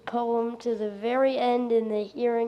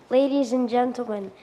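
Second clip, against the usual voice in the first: ordinary talk, nothing marked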